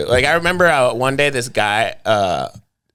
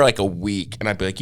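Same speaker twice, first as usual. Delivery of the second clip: normal talking voice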